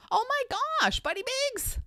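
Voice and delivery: high-pitched, thrilled call-out; excited greeting. Real, not acted. Streamer spotting a viewer in the chat, excited. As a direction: squeal with delight at a name.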